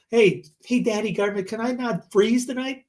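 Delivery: high, whiny mock plea; mock pleading. Asking the government for permission, high mock plea. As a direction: beg like a helpless kid.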